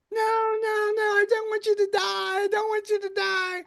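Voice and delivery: high, frantic pleading; pleading wife voice. Retold or imitated. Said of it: "the wife goes".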